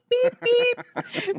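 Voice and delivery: high bleep sound mimicking a TV censor. Hosts joking about bleeped swearing, imitating a censor bleep. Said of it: "needed a lot of beep".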